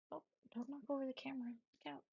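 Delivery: quick whisper so the dog won't wake; hushed, fast warning to the dog. Real, not acted. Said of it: "I don't know why I'm whispering".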